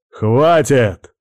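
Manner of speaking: loud furious shout; yelling. A narrator's reading. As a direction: yell at full throat. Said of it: "заорал он во всю глотку".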